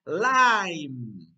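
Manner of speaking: "lime" belted long with a falling glide; slow, emphatic "lime"; model for repeating. Shown as a demonstration. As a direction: stretch each "lime" for children to echo. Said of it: "Repeat after me".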